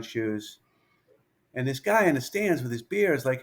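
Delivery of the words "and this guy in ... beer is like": ordinary delivery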